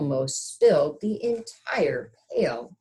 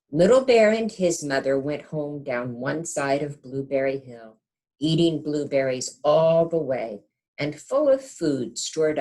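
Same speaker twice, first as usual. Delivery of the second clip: gentle storybook narration; reading to children; 5 dB louder than usual. A narrator's reading. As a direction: read it like a bedtime picture book. Reads picture book aloud.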